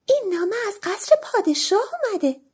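high, excited Cinderella sharing news; happy announcement. A narrator's reading. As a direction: announce the royal letter with delight.

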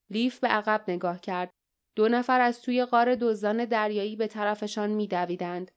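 plain reading voice

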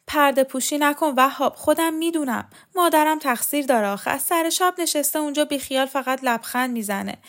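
high-pitched, indignant tirade; indignant, distraught aunt. A narrator's reading. Aunt rails to her nephew about her shameless mother, indignant.

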